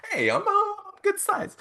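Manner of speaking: put-on high, laughing kid voice; hammed-up high child voice; mock little kid. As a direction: play the toddler pleased with her size.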